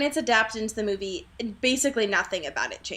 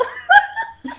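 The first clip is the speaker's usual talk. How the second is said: high laugh; 7 dB louder than usual. Real, not acted. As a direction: burst of high-pitched laughter. Laughing at Jacob's ten dollars.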